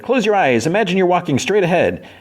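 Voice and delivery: high-pitched instructing voice; guiding bug. Retold or imitated. Bug talks Fiona towards Finn's mind room, high guiding voice. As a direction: play a little guide giving instructions.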